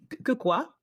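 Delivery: high, stammered disbelief; stunned. Retold or imitated. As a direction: sputter in shock. Stunned by antidepressant question.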